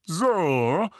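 full volume. A narrator's reading. Uncle Vernon rounds on Harry, loud. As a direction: boom it out. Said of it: "zu voller und beträchtlicher Lautstärke erhoben".

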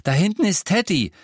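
put-on high, loud, breathless boy with news. A narrator's reading. Boy runs back to his family with news, breathless. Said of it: "sagte er atemlos".

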